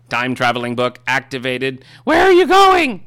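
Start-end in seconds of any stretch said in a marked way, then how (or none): 2.0-3.1 high excited cry. Acted. Excited character cry in the sketch.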